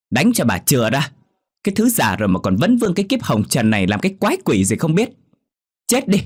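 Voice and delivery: high, loud hateful rant; furious yelling. Acted. Daughter beating her old mother, high and furious. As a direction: scream "Chết đi" at the top.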